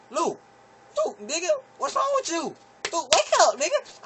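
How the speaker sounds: high frantic calling; urgent call. Retold or imitated. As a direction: snap him out of it. Shaking frozen cousin awake.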